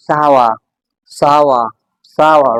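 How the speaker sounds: "सावा" as "SAH-wah" with open ah vowels; careful teacher's "सावा"; correct pronunciation. Shown as a demonstration. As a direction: model "सावा" in two falling beats. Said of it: "the correct way to pronounce this word".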